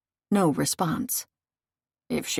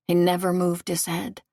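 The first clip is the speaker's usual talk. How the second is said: ordinary delivery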